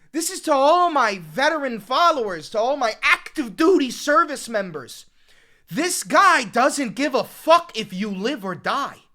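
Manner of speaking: loud, urgent warning rising to a yell; too loud. Real, not acted. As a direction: rant at full force, hammering each place in the list. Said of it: "my audio's getting too loud".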